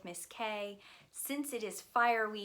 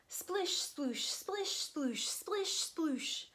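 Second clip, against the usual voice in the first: playful water sounds; 9 semitones higher, much slower than usual. Acted. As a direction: make the hose splash for the kids. Water spraying from hose.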